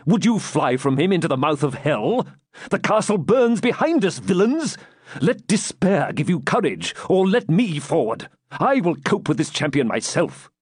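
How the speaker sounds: high, impassioned battle exhortation; rally cowardly soldiers from the front; defiant Norman commander, urging. A narrator's reading. Plays De Bracy rallying his men.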